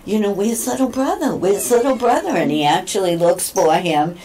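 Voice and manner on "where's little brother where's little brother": pet talk. Retold or imitated. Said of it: "I say to him".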